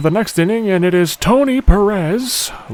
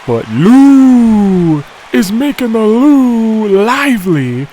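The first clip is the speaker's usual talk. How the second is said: excited call; 6 semitones higher, 7 dB louder, slower than usual. Real, not acted. Streamer calling a big hit.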